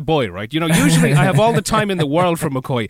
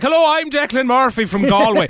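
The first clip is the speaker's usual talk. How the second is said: a hammed-up, higher, louder stage Irish accent; bad Irish accent; 7 semitones higher, 4 dB louder than usual. Dreamed bit part on a network TV show, hammed-up Irish accent. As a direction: overdo the Irish accent on purpose, pitched up. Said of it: "make my Irish accent worse on purpose".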